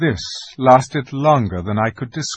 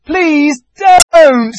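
much higher and louder, desperate; shouted plea; 12 dB louder, much slower than usual. Acted. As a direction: beg the witch to stop. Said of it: "shouted Edmund".

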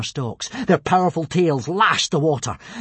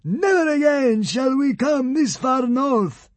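high, wailing, grovelling plea; slow, high-pitched tearful surrender; sobbing; 13 semitones higher, 4 dB louder than usual. A narrator's reading. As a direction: wail it out, begging a god for mercy. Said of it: "he sobbed".

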